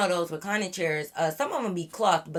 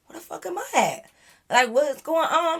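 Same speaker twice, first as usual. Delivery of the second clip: confused; 8 semitones higher than usual. Retold or imitated. Waking up in strange house.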